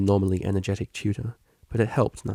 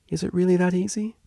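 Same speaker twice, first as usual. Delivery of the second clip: higher, hopeful question; student hoping for an easy answer, lifted; asked hopefully; 10 semitones higher than usual. Acted. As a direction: ask as if hoping it's true. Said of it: "she asked hopefully".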